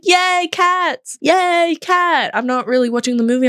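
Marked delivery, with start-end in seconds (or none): 0.0-2.3 excited cheer. Retold or imitated. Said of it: "And I'm like".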